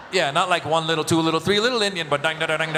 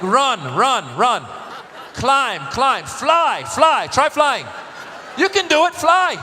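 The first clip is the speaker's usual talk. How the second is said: louder, higher coach-like urging; cheering the kids on; 4 semitones higher, 3 dB louder, much slower than usual. Retold or imitated. Dad urging toddlers to run and fly, loud cheering. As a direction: pump up tiny kids to wear them out. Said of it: "I'm like".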